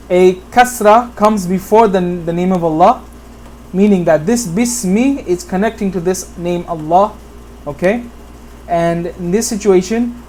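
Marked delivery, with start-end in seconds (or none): none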